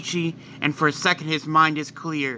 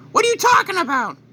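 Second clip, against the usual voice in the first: much higher, alarmed wife voice; high Chi-Chi voice; 14 semitones higher, 4 dB louder, faster than usual. Acted. Wife shouting at husband who attacked her, high and alarmed. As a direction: cry out in a high, shocked pitch.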